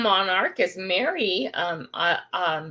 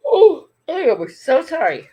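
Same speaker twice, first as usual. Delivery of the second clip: startled apology; 7 semitones higher, 9 dB louder than usual. Real, not acted. Apologizes mid-stream, startled.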